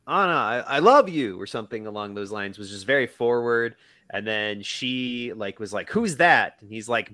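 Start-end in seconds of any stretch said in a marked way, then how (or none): 0.1-1.4 mock yell out of the dark; retold yell. Man yells to an actress from the dark, retold yell. 5.9-6.6 mock shout of a question into the dark; retold calling out. Actress calls back to an unseen admirer, retold shout.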